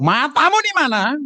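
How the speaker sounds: high, drawn-out indignant yell; angry Medan retort. Acted. Medan local whose foot was stepped on, high indignant outburst. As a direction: snap back high, stretching the words. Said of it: "kaki kita diinjak".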